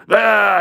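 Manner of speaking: high, loud "ah" of outrage; imitated wordless holler; mock angry yell. Grumpy man yelling back at me, mock yell. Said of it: "He starts yelling back at me".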